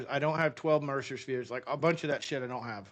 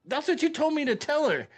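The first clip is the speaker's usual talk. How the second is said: indignant protest; 12 semitones higher, 4 dB louder than usual. Retold or imitated. Arguing with the Katusa.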